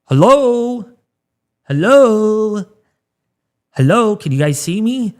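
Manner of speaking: high, loud "Hello?" called out three times, like shouting for attention; mock calling out. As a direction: call out to people who aren't listening. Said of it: "it's like".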